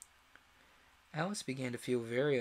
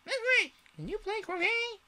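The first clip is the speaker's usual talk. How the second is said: very high and loud royal cry; queen calling out to Alice; shouting Queen; 6 dB louder than usual. A narrator's reading. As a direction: bellow it like a queen.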